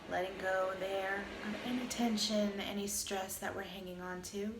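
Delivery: calm, even meditation voice with long pauses; guided meditation. Acted. Teacher guiding listeners through a body scan, calm meditation voice. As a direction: lead a body scan, unhurried, leaving space between phrases.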